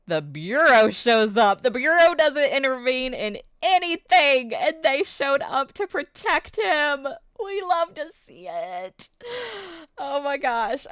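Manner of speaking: loud, high, choked-up gush; nearly crying with excitement; excited, teary. Real, not acted. Excited retelling, emotional climax.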